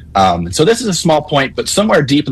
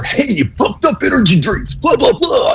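hammed-up energy drink announcer, much higher and pushed to a shout; cheesy radio ad voice; 12 semitones higher than usual. Caller mocking the Northern ad reads with a hammed-up cheesy radio shout. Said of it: "really cheesy radio voice".

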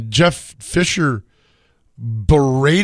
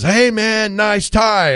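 higher, mock friendly heckle; mock fan call; 7 semitones higher, much slower than usual. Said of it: "a fan says".

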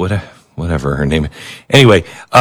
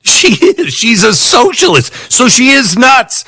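raised, teasing voice, sarcastic jab; high mock aside; 11 semitones higher than usual. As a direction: pitch it way up and tease. Mock aside about her.

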